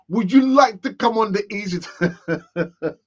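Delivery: put-on invite, slow, breaking into laughter; laughing mock invitation. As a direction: ask politely but crack up halfway. Invites the next person, laughing.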